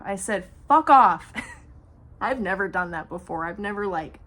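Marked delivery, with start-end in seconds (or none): 0.7-1.3 loud, sharp yell; shouted fuck off. Retold or imitated. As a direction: blast it at him to end it. Shouting at the man.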